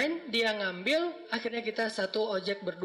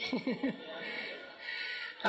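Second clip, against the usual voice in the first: soft laugh; 3 semitones higher, 8 dB quieter than usual. Real, not acted. Laughing at a listener's confession.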